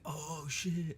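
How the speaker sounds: high-pitched, drawn-out awe; an imitated fan's excited realization; mock excited reaction. Imagined viewer hearing of the Avengers, mock excited.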